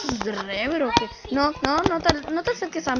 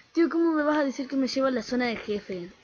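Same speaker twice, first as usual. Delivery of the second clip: ordinary delivery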